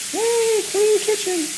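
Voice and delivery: high squeal of delight; excited cheer. Real, not acted. Cheering her own clean kitchen, high and excited.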